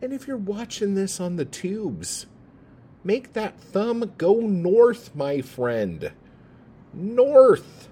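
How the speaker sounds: a mock-excited call to give a thumbs up; hammed-up cheer. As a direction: hype the listener up like a game-show host.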